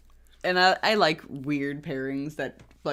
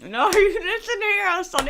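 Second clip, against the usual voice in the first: mocking accent imitation; 5 dB louder than usual. Co-host showing the host how they sound, mocking accent imitation. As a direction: exaggerate the host's bad accent in a high squawk. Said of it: "you sound like this".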